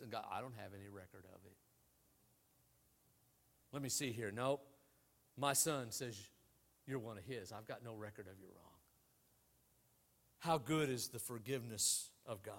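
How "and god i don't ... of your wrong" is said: gentle God. Retold or imitated. God voiced on the phone.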